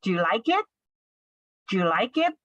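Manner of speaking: "like it" lifting a bit at the end; rising question intonation. Shown as a demonstration. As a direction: ask it with a rise on "it". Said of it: "a bit rising intonation in the end".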